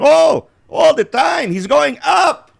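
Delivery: high, loud, slow sing-song Serbian accent; high-pitched mock Serbian. Shown as a demonstration. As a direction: show the overdone high pitch. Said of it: "not that high pitch".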